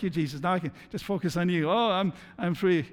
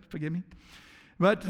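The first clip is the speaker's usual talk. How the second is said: usual speaking voice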